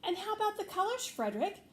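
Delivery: worried mice pleading; hungry mice ask Frederick anxiously. Acted. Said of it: "they asked anxiously".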